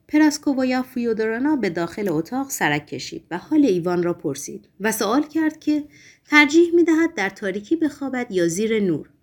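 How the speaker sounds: plain reading voice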